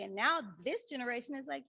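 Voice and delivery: ordinary delivery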